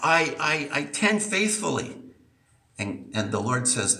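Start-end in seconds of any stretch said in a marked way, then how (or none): 0.0-2.0 higher, self-justifying churchgoer voice; religious person pleading. Retold or imitated. Preacher voicing a churchgoer defending himself, higher and pleading. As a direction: plead your good deeds in a raised voice. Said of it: "Someone hearing my voice this morning and says".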